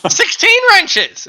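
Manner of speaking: high gloating villain voice; mad scientist. Acted. GM villain gloats over the players.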